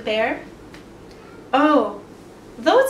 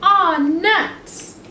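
higher, louder aw nuts; disappointed; 5 semitones higher, 4 dB louder than usual. Acted. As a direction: groan it like a let-down bunny. Bunny's disappointed exclamation.

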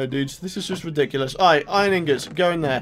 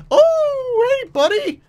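much higher, bright hello to the mob; high excited greeting; 4 dB louder, much slower than usual. Real, not acted. Gamer greeting a new villager, pitched high. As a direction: greet him with a high squeak.

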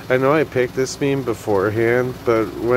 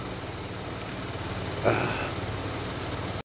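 long tired sigh; 2 semitones lower, 13 dB quieter than usual. Real, not acted. Tired sigh before relaxing.

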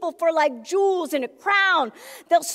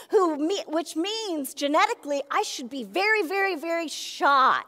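ordinary talk, nothing marked

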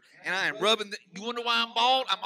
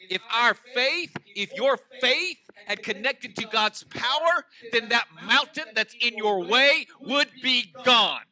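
slow, rising to louder; building preaching; 2 semitones higher, 6 dB louder, slower than usual. Real, not acted. Preacher building to a climax before the church, slow and louder. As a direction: drive each phrase home slowly.

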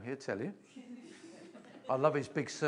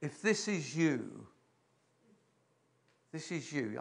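gentle appeal; slower than usual. Real, not acted. Appeal to congregation.

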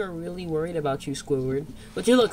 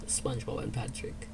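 whisper; 7 semitones lower, 13 dB quieter than usual. Acted. Hiding, repeats name softly.

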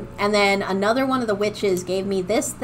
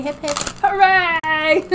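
high shouted hooray with laugh; laughing cheer; 8 semitones higher, slower than usual. Real, not acted. Cheering as avatar works again.

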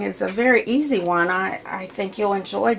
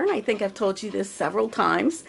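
normal talking voice

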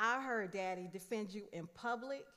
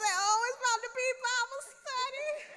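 excited; 8 dB louder than usual. Retold or imitated. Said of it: "I said".

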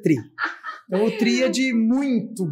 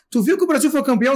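fast, higher-pitched announcement; acted exciting news; 5 semitones higher, faster than usual. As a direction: break big news quickly, pitched up. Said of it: "dizer pra mim assim".

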